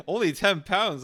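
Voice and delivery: amused giggle while repeating the price; laughing. Real, not acted. Streamer reacting to his AI character's price, laughing.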